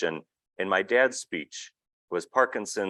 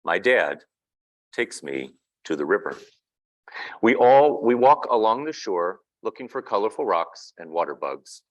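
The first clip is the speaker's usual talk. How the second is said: picture book reading; 3 semitones higher, 6 dB louder than usual. A narrator's reading. Reading a picture book aloud to an audience, storybook narration. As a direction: read the child's words gently to the room.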